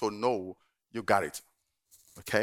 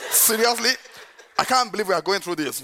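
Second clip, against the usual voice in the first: higher, louder laughing exasperation; exasperated wife; 5 semitones higher, 5 dB louder than usual. Retold or imitated. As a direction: roll your eyes at the fuss. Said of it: "all Bola did".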